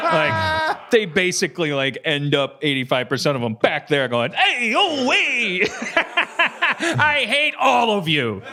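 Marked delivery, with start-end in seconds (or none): 4.3-8.4 imitating a kid grown up to yell at the crowd; mock drunk yell. Imitating the grown-up Vegas kids.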